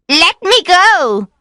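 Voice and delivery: high, loud, slow plea to be let go; crying out. A narrator's reading. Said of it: "she cried".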